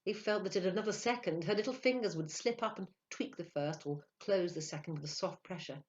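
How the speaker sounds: ordinary delivery, nothing marked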